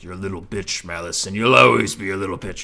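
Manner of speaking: low, taunting put-on voice; Zarkan. Villain taunting Malice in the background, low mocking voice. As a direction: needle him from the background, pitched low. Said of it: "Zarkan in the background's being like".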